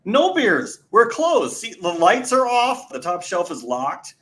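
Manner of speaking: high, slow, over-explaining; exasperated refusal. Retold or imitated. Bartender refusing persistent customer.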